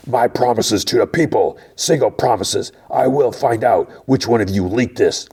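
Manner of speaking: high, strained angry tirade, acted out; throaty mock yell. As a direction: imitate a boss threatening the whole staff. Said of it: "and yells".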